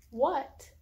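slow wondering question, then loud high quacks; surprised then quacking. Acted. Revealing the duck to her cats, loud playful quacks.

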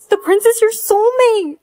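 gasp, then a high excited exclamation; astonished. Acted. Reacting to the prince being a soulmate, astonished. Gasps at a startling revelation.

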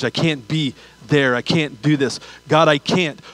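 ordinary talk, nothing marked

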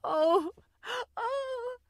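high, fading tearful moans; sobbing. Acted. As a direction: let the sobs die away. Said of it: "She was crying very much".

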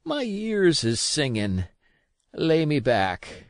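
put-on slow, faint-hearted old sailor; a sick man giving up; failing sea dog asking to lie down; weak old captain. A narrator's reading. Captain collapses weakly.

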